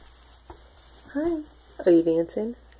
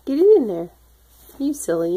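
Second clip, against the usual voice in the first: ordinary talk, nothing marked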